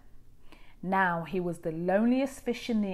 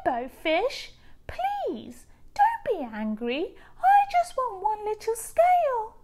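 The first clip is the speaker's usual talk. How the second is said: higher voice, hushed on don't be angry; gentle pleading little fish; 13 semitones higher, 6 dB louder than usual. A narrator's reading. Little blue fish gently asks rainbow fish again.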